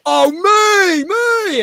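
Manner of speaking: high, loud "me, me!" yelled out; a punter calling out high and loud, desperate to be picked; eager shout from the back. Retold or imitated. Audience member at the back volunteering, shouting high and eager.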